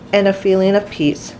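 ordinary delivery, nothing marked

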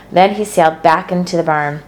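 ordinary delivery, nothing marked

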